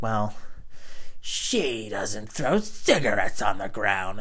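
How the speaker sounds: slow, drawn-out awkward excuse; mock embarrassed neighbor. Neighbor caught out defending her smoking friend, mock embarrassed. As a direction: stretch out a sheepish put-on defense. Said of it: "looked at me all embarrassed".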